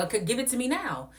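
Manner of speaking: high, loud impatient demand; high loud demand to the host. Retold or imitated. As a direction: demand it now, fed up. Own demand in argument.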